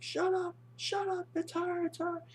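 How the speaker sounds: very high falsetto mock panic; mental breakdown. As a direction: shrilly refuse to believe it, hammed-up. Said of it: "He's having a mental breakdown".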